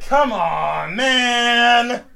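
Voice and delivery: loud, high, long-stretched wail; exasperated yell. Pretend. Host protesting to Chris outside, drawn-out loud yell. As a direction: bellow it out, dragging every word. Yelling at Chris, escalated take.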